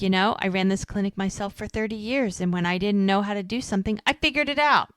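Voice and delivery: ordinary talk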